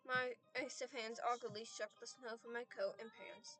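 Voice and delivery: normal talking voice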